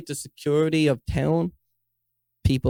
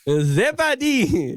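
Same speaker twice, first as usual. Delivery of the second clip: slow, high, drawn-out "Zebadi" in a put-on African accent; mock African accent; 9 semitones higher, much slower than usual. Joking friend slips into a put-on African accent, slow and high. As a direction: stretch the name out, way up in pitch. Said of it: "You keep going African".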